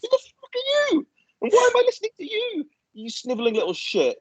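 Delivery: high, mock-outraged squeal; incredulous outrage. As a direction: squeal it in disbelief. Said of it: "I'm like".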